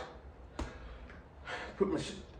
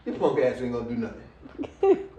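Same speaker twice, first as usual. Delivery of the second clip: lower, laughing voice for the sneering rat; smug rat's taunt; 6 semitones lower than usual. Retold or imitated. The rat sneers at the speaker, a low, laughing mock taunt. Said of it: "The rat was like".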